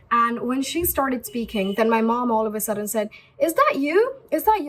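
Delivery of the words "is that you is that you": high, loud surprised question; surprised mom. Retold or imitated. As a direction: imitate mom's amazed double-take. Mom surprised hearing her voice.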